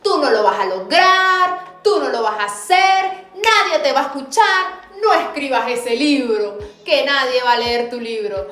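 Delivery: preacher acting out the enemy's discouraging voice, loud and taunting. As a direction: hurl doubts like the devil whispering defeat, but loud. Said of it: "Acalla esa voz que siempre te dice".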